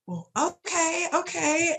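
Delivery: high, slow, drawn-out hesitant acceptance; wary okay. Retold or imitated. Her wary reply to odd news.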